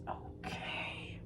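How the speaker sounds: slow, low whisper; slow whispered aside. Real, not acted. Aside after confession.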